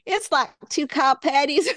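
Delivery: attempted Texan accent, pitched high. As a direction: put on a mock Texan twang. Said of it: "say it in a Texan accent".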